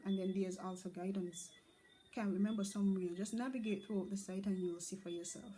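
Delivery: ordinary talk, nothing marked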